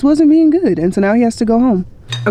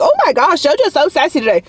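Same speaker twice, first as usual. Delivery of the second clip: high-pitched, quick imitation of a bystander; mock gushing onlooker; 7 semitones higher than usual. Onlookers at the dog park commenting on her sassy dog, mocking imitation. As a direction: put on a high, gossipy onlooker voice.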